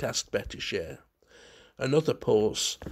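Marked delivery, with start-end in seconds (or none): none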